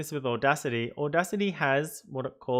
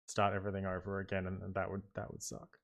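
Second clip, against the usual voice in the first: fading to a whisper; 6 semitones lower, 9 dB quieter than usual. Real, not acted. Fears losing the project.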